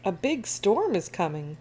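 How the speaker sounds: plain reading voice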